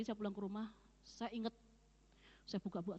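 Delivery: ordinary talk, nothing marked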